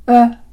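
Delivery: う as a neutral mid vowel, neither u nor o; relaxed central う, lips loose; Lojban schwa. Shown as a demonstration. Teacher demonstrating the Lojban schwa, neutral mid vowel. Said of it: "子音の間の区切りに使われる音素".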